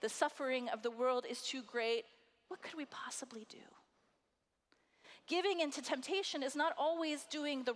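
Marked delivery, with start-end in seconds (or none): 2.5-3.7 hushed, breathless whisper of helplessness. Acted. Preacher whispering the world's despair to the congregation. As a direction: whisper it like giving up.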